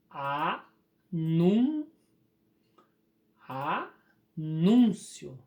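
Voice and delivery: anúncio split with long gaps, a... nún... cio; very slow, isolate each part of "anúncio"; syllable by syllable. Shown as a demonstration. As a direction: break "anúncio" apart, NÚN firm and loud. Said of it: "Você vai separar aqui".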